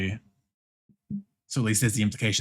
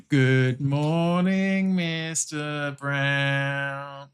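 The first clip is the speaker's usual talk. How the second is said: very slow, drawn-out schoolchildren's chorus; class in unison; 4 semitones higher, much slower than usual. Acted. Class greeting the teacher in unison, slow singsong drawl. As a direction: drag out every syllable like a class greeting. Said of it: "the class in unison".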